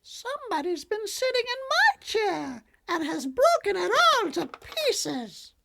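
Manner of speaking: tiny bear squealing over his broken chair; Baby Bear squeal. A narrator's reading. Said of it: "Then Baby Bear squealed".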